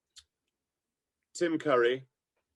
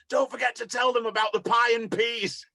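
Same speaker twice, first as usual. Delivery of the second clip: club owner; 12 semitones higher, 6 dB louder than usual. Retold or imitated. Club owner's reminder retold.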